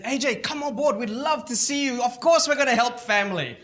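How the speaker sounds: high, loud welcoming; mock welcoming cousins. Cousins pretending to welcome A J warmly, high and loud. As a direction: gush like eager relatives. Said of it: "They said".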